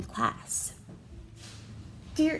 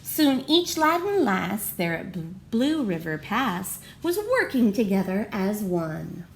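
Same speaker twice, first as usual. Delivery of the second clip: ordinary delivery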